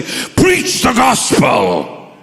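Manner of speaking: booming, drawn-out shouted command; massive voice. Retold or imitated. Voice from all around commands the young man, massive shout. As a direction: thunder it slowly from all around. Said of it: "I heard a massive voice from all around me say".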